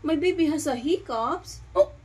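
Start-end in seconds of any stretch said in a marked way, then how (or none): none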